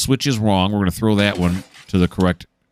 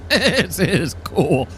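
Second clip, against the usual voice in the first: very slow, higher, drawn-out awe; awed; 10 semitones higher, much slower than usual. Real, not acted. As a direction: marvel at it, stretching every word. Awed by the coupling detail.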